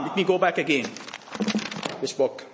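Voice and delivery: normal talking voice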